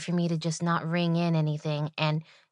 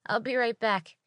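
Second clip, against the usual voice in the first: fake polite server; 6 semitones higher than usual. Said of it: "And I was like".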